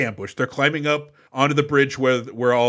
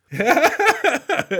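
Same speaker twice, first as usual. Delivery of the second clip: sudden high-pitched laugh; real laugh at a teasing joke; high burst of laughter; 13 semitones higher, 4 dB louder than usual. Real, not acted.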